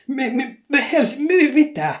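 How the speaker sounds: high, loud, stammering splutter; sputtering. A narrator's reading. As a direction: choke on the words in outrage. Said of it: "ähinäänsä".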